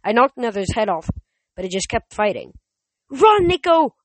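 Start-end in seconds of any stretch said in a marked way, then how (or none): 3.1-4.0 high, loud urgent warning; yell. A narrator's reading. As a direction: yell to send someone fleeing. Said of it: "I yelled".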